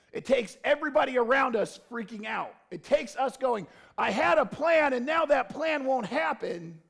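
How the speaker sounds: loud, impassioned preaching build; loud pulpit preaching; full-force preaching. Acted.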